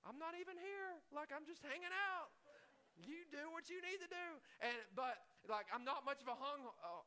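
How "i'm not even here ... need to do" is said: high, mocking disbelief; sarcastic exasperation. Driver in the median mocking the U-turning car, high and sarcastic. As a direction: throw up your hands at the driver. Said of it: "And I was like".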